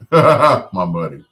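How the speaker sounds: laughing through the words, slow; a fond laugh breaking into the line. Real, not acted. Laughing while naming mom.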